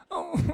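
dainty mock sobbing; high, stuttering fake whimper; pretty crying. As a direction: imitate delicate little sobs. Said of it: "pretty crying".

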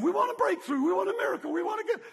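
high, whiny demanding voice; mock the impatient believer. Churchgoers demanding a miracle, high whiny voice. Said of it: "and we're saying".

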